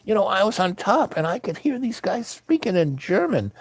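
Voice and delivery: high-pitched, eager retelling; excited discovery. Retold or imitated. Retold excited discovery to the guy.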